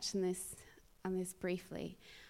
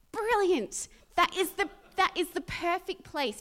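loud, high burst of enthusiasm; emphatic delight; 8 semitones higher, 8 dB louder than usual. Real, not acted. Preacher cheering a hated job as a mission field, emphatic delight. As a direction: exclaim it with real gusto.

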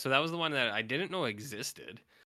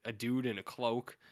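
ordinary delivery

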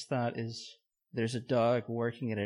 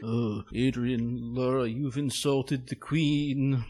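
slow British ghost voice; ghostly British; slower than usual. Pretend. British ghost scolding Adrian Laura over the Queen, slow spooky British accent. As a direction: stretch it out like a haunting British ghost. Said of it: "Was that a ghost British person".

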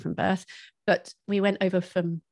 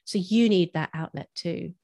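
ordinary talk

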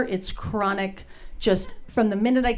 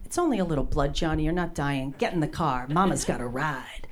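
low, soft, dismissive mom voice; unsympathetic mom; 6 semitones lower, 3 dB quieter than usual. Acted. Not-so-nice mom to her bleeding kid, low dismissive tone. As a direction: brush the kid off in a low mutter. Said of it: "I'd be the mom looking at the kid".

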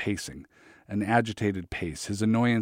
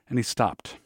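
ordinary delivery

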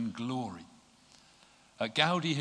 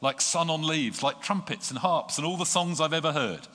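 high, loud, ecstatic outpouring; overflowing joy; 7 semitones higher, 5 dB louder than usual. Acted. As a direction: pour it out like spring after winter. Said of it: "And laughing".

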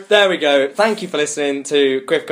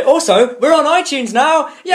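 higher and louder, cheering; excited announcement; 11 semitones higher, 3 dB louder than usual. Real, not acted. Announcing to listeners they are on iTunes, cheering. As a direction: shout the news with glee.

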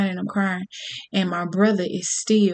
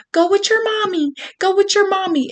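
much higher-pitched urging voice; high, pressing voice urging a child; imitated urgent woman; 11 semitones higher than usual. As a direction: act out a woman urging from hiding.

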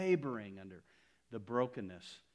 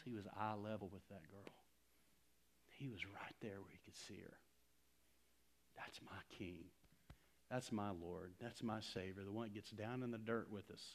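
softer, almost whispered awe; hushed tender; 3 semitones lower, 11 dB quieter than usual. Acted. Preacher telling the church about his King, hushed and tender. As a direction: draw them in close and quiet.

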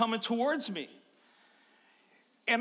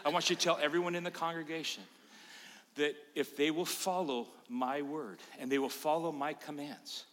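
lower, softer and quick divine instruction; lightly voiced angel, lower and hushed; 5 semitones lower, 7 dB quieter than usual. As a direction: hand down the commission quietly. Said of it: "the angel said to me".

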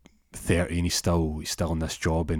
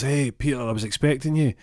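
higher-pitched greeting at normal volume; corrected manager voice; 5 semitones higher than usual. Retold or imitated. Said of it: "doesn't speak like that".

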